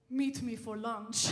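put-on slow, deep, thick Russian accent. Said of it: "in his deep, thick Russian accent".

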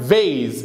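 فيز said "veiz" with a voiced v; correct English V. Shown as a demonstration. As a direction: say فيز as English "vase", buzzing the v. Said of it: "اسمها فيز".

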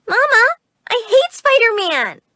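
put-on high, loud little-kid complaint; child's protest. Child protests at birthday party.

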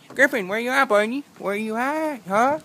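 high, carrying call. Real, not acted. Calling the dog Griffin down the road, high call.

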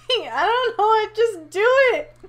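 high, loud, slow words through laughter; laughing. Real, not acted. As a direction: giggle while you talk. Laughing at his own line.

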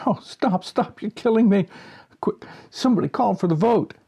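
high, breathless pleading through giggles; helpless laughter. Acted. Chairman begging the directors to stop, helplessly giggling. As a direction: beg them to stop, you're dying laughing.

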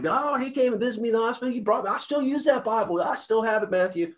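imitating a grateful friend in a very high-pitched voice; gushing, high mock voice without added volume; excited friend. Friend telling others about the hospital visit, high-pitched gushing. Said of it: "if we're around somebody".

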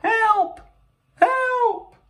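very high-pitched cry for help; shrieky little storybook alarm; panicked squeal. Acted. Panicked character calls for help.

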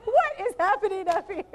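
high, loud, laughing exclamation; amused outburst breaking into giggles; laughing disbelief. Real, not acted. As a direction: blurt it out high through real laughter. Laughing outburst at commotion up front.

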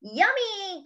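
high-pitched eager exclamation; crying out. Acted. Pig invited to Thanksgiving dinner, crying out eagerly. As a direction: exclaim with storybook relish. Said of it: "cried Pig".

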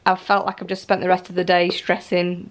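normal talking voice

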